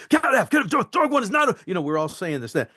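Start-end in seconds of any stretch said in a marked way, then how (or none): 0.1-1.6 frantic, pitched-up rush on "dog one is not o-"; freaking out. Retold or imitated. Actor redoing the radio line for the director, freaking out. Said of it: "you're freaking".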